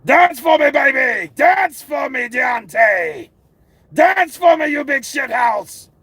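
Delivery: loud high taunting yell; mocking shout. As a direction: jeer at him, full voice. Mocking boxer, yelling dance.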